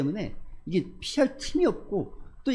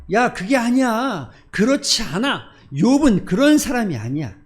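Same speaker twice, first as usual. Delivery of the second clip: louder, slower, higher insistent denial; God's firm rebuke; 6 semitones higher, 9 dB louder, slower than usual. Acted. Preacher voicing God rejecting Satan's accusation, a loud, firm rebuke. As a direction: boom it out as God defending Job. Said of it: "하나님은 아니라고 말합니다".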